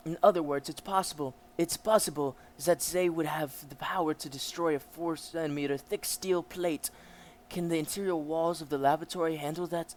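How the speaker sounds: lightly voiced dialogue read; light character reading; reading a manga aloud. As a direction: read the scientists' lines out.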